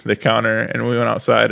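usual speaking voice

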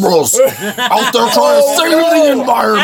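high, strained raving rant; Alex Jones impression. Host raving about liberals and forest fires as Alex Jones. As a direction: rant like an outraged conspiracy host. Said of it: "Alex showed up".

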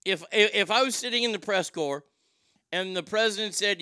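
ordinary delivery

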